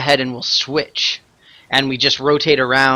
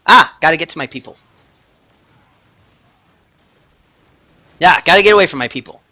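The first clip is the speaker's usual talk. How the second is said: hurried urgency; 3 semitones higher, 7 dB louder, much faster than usual. Real, not acted. Hurrying in boss fight.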